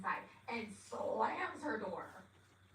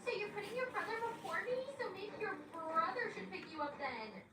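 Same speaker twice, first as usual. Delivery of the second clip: high, petulant mock whine; childish whining; 10 semitones higher than usual. Girlfriend resents his brother coming first, childish whining. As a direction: imitate a sulky child protesting. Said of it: "She is acting like a child".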